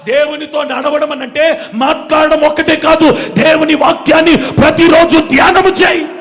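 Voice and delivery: high, building to a shout; full-force preaching. Real, not acted. Preacher urging daily meditation on the word, shouted preaching.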